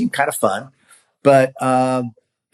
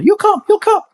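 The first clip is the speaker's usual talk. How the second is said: imitating eager women; 6 dB louder than usual. Mother and sister inviting him.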